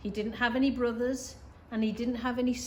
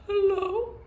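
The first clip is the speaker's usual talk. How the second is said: much higher, loud call back; echoing call; 9 semitones higher, 6 dB louder than usual. Acted. Voice across the pond to Little Beaver, high echoing call. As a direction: answer like an echo from afar. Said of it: "said the voice from across the pond".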